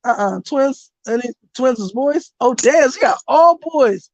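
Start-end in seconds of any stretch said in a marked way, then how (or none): none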